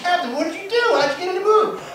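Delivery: high-pitched, acted astonishment; amazed stewardesses. Stewardesses asking the captain, high-pitched and amazed. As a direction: squeal the question in mock amazement. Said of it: "the stewardesses are amazed by this".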